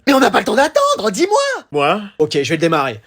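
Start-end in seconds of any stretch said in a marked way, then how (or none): 0.1-1.6 high, pressing demand; impatient urging. Acted. Parent pressing late child, impatient. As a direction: push for an answer, pitch leaping. Hurrying parent for lesson time.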